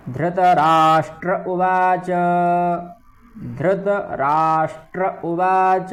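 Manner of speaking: उवाच held slowly with a complete च; full cha. Shown as a demonstration. Teacher drilling the full च in उवाच. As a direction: stretch उवाच and land fully on च. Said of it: "चा का पूर्ण उच्चारण करना है".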